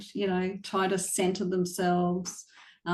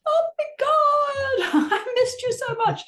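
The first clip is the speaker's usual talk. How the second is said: much higher, slow, laughing gush; hammed-up squeal of missing the dog; over-the-top gushing; 5 dB louder than usual. Owner comes home and greets the dog, laughing, much higher gush. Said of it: "doesn't have to be a great big".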